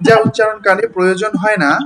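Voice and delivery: ordinary talk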